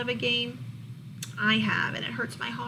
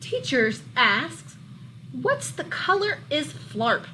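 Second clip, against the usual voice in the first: lively, louder storybook read-aloud; reading to children; 3 semitones higher, 4 dB louder, slower than usual. A narrator's reading. Teacher reading a monster book to her class, lively storybook voice. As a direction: bring the picture book alive for the class.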